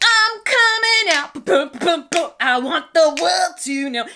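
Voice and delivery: high squeaky newborn voice, slowed at first; baby voice. Retold or imitated. Narrator voicing herself as an unborn baby demanding out. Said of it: "my head is like".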